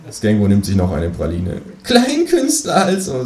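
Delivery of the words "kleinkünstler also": high, slow, smug kangaroo. Acted. The kangaroo sums up the narrator, slow and high. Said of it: "sagt es und lacht kurz auf".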